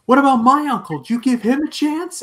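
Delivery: high, loud mock yell; hammed-up outraged yell; mock shout. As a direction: yell it like the wronged nephew. Voicing Peter confronting the killer.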